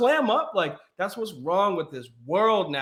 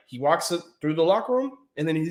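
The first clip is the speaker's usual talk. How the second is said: normal talking voice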